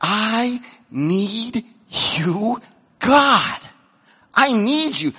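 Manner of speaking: slow, quiet, urgent need; mean every word. Acted. Sermon, desperate prayer to God. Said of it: "desperation that says".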